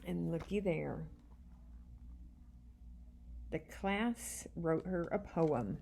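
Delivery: ordinary delivery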